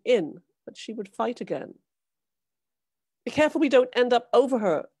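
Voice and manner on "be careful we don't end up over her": urgent warning. A narrator's reading. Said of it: "said Thomas Clayson".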